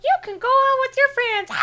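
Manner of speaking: squeaky voice for the ninja. Acted. Voicing ninja character.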